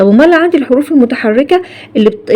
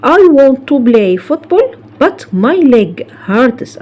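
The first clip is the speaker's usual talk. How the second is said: slow, deliberate English example; careful dictation pace; slow model sentence; 2 semitones higher, much slower than usual. Shown as a demonstration. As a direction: read it slowly for students. Teacher models example sentence slowly.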